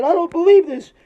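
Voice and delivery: high, loud comic wail; hammed-up tearful whine; mock crying. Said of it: "they're fucking crying".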